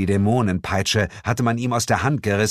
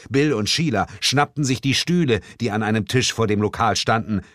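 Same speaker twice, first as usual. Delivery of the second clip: ordinary talk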